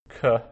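"K" with a very short uh after it; a quick, isolated "kuh" for "K"; very short shva. Shown as a demonstration. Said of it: "that cup indicates a very short shava".

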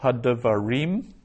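Hadavarim said slowly, stressing RIM; stress on final syllable. Shown as a demonstration. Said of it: "that it's the reem".

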